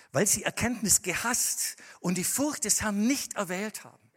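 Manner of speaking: loud, high scripture proclamation; preaching at full force. Acted. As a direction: declaim the verse to the hall.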